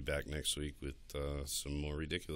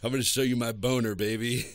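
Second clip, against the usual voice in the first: louder, higher, laughing sleazy line; hammed-up sleazy come-on; mock sleazy guy; 7 semitones higher, 11 dB louder than usual. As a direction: laugh through a cheesy come-on. Imitating a guy's crude text.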